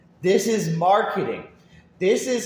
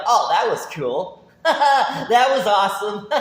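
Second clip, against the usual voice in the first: mock gushing; 3 semitones higher, 5 dB louder, slower than usual. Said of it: "make me be like".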